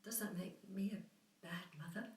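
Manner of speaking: soft, half-whispered question, rising at the end; hushed self-doubt. Acted. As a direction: ask it quietly, almost to yourself. Doubting herself as a mother.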